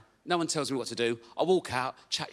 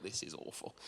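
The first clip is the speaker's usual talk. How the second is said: whispered, low and hopeless; despairing whisper; 11 semitones lower, 18 dB quieter than usual. Retold or imitated. Man at a Zoom assessment, whispering in despair. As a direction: breathe it out defeated.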